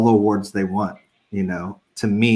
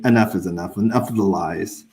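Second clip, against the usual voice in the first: normal talking voice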